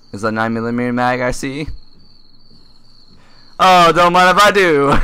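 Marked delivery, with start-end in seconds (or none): 3.6-5.0 higher, louder happy greed; delighted grab. Real, not acted. Gamer grabbing loot, gleeful. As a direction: pounce on the loot with glee.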